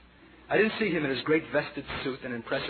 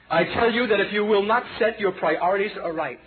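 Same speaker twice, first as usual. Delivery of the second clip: prophetic rebuke; 6 semitones higher, 5 dB louder than usual. Retold or imitated.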